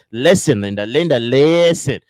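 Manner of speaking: loud, drawn-out playful insistence; hammed-up demand for attention; mock insistent plea. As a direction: stretch it out loudly, pleading in jest.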